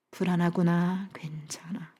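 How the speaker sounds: low, hushed reassurance fading to a whisper; tender soothing. Retold or imitated. Husband holding his anxious wife close, hushed tender soothing. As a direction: murmur comfort to someone anxious. Voices husband comforting her.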